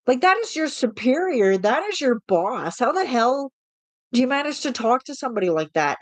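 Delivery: high, incredulous, outraged mock; indignant. Telling off a coworker for sassing the boss, high and incredulous. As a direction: rise up high in disbelief. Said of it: "I'm thinking, I'm like".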